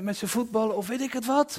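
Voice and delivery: normal talking voice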